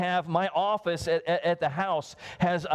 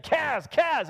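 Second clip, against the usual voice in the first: calling out; 8 semitones higher than usual. Retold or imitated. Woman calling out to interrupt.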